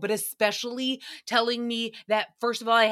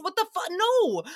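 appalled; 9 semitones higher than usual. Real, not acted. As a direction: recoil in horror at the thought. Appalled at servant idea.

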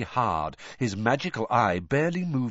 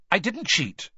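higher, quick sharp denial; 10 semitones higher than usual. A narrator's reading. Harry denies cheating to Moody, sharp. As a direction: snap back the denial. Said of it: "said Harry sharply".

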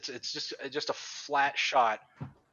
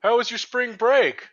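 high, slow chipper dad voice; cheery dad; 9 semitones higher, 4 dB louder, slower than usual. Retold or imitated. Clueless dad greeting his son on the phone, high and cheery. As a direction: play the oblivious happy father. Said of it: "he's like".

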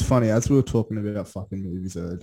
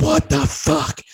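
much higher, louder frightened cry; shocked fright; 7 dB louder, much slower than usual. Retold or imitated. Girlfriend at the film's jump scare, high frightened yelp. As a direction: jolt up in fright at the ending. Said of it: "she's like, she's like".

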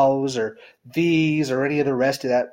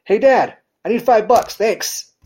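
casual, higher and louder ask; breezy kid sure of the yes; a child casually asking his father for five bucks, sure of it; confident kid asking Dad; 7 semitones higher, 6 dB louder than usual. Retold or imitated. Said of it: "I'm just gonna use Dad".

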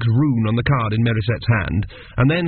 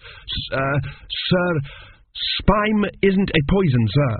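higher, faltering student; stumble, caught off guard; flustered stammer; 6 semitones higher, slower than usual. Acted. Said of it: "he managed".

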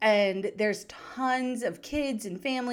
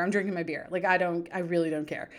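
ordinary talk, nothing marked

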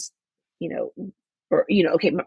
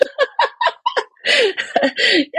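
a wet laugh through tears; tearful laugh; 6 dB louder than usual. Real, not acted. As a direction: laugh while still crying. Laughs after crying about it.

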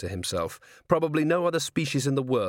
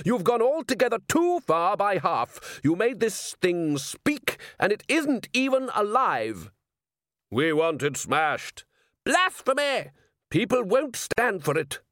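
angry priest; 10 semitones higher than usual. A narrator's reading. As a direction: scold like an outraged priest.

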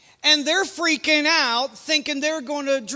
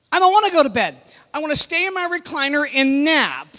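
mock protest; 3 semitones higher, 4 dB louder, faster than usual. Napping dad refusing to go to bed, mock protest. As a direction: object loudly like a reluctant napper.

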